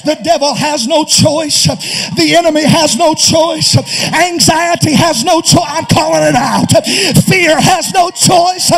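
fiery sermon shout; preaching at full force. Real, not acted. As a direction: thunder it from the pulpit.

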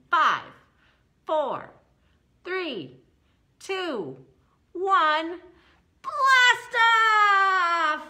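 each number climbing to a big shouted launch; excited countdown. Pretend. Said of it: "That was so much better".

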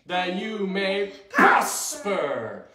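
slow, measured group recitation; verse with actions. Shown as a demonstration. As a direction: lead it phrase by phrase for followers. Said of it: "Here we go".

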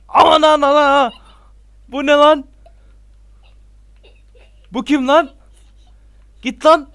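high, loud frightened cries; panicked yelling. Real, not acted. Streamer spots a ghost in his house, yelling in panic. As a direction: yell in alarm at the ghost.

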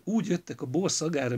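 ordinary delivery, nothing marked

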